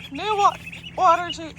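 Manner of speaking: hiccup cuts the word; talking through hiccups. Real, not acted. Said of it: "Sorry, I got the hiccups".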